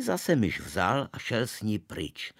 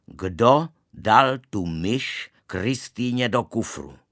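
slow, much louder demand; angry interrogation; 3 semitones higher, 8 dB louder, slower than usual. A narrator's reading. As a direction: grill the boy word by word. Father holding boy by ear. (tatínek vyslýchá syna, pomalu a mnohem hlasitěji)

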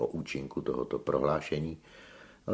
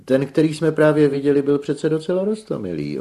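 high, loud operatic flourish; booming high-pitched vanity; theatrical tenor; 13 semitones higher, 13 dB louder than usual. A narrator's reading. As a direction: project grandly like a stage singer. Theatrical tenor answers the boast.

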